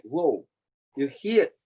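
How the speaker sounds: ordinary talk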